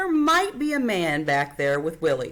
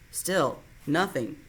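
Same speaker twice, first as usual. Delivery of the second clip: ordinary delivery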